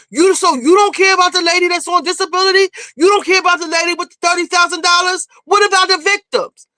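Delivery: high, indignant mock confrontation; accusing challenge. Acts out Evelyn's accusing challenge.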